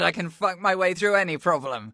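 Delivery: ordinary talk, nothing marked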